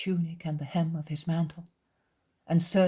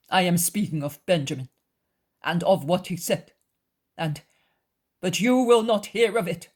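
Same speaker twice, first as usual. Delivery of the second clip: louder, emphatic, rising in pitch; insistent cousin; 4 semitones higher, 7 dB louder than usual. A narrator's reading. Cousin warning Jesus about Judas, mounting insistence. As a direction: press the accusation harder with each phrase.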